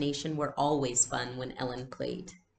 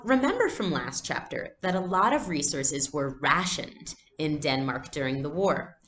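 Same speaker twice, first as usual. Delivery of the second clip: usual speaking voice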